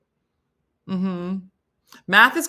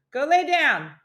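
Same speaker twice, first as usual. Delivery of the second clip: high-pitched raised command; yelling to dog; 8 semitones higher, 4 dB louder, slower than usual. Real, not acted. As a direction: send the dog off with a high holler. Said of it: "He can't, I'm yelling".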